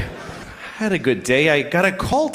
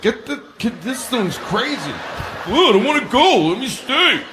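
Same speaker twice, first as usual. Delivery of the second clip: high voices, the first much softer; complaining tree, clinging orange; 6 semitones higher, 4 dB quieter than usual. Acted. Tree shedding its rotting orange, which begs to stay, high and whiny. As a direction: act out a quick squabble in small high voices. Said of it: "and the tree's like".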